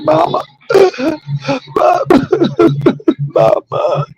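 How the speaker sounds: high-pitched exaggerated sobbing call to mother; mock boo-hooing. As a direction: imitate yourself wailing for mama, half laughing. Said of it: "I mean, I boo-hooed".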